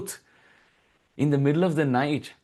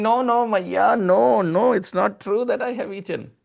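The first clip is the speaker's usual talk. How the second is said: put-on pleading child; 7 semitones higher than usual. Child Krishna pleading with mother Yashoda, high and insistent. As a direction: beg like a small boy caught out.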